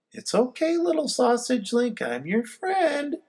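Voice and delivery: put-on boy calming a nervous puppy; reassuring. A narrator's reading. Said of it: "I reassure the dog".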